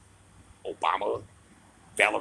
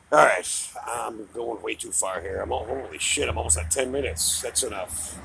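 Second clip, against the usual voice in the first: ordinary talk, nothing marked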